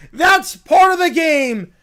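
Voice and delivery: loud, theatrical comeback; shouted retort. Acted. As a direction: fire it back at full volume. Acted character defends the game.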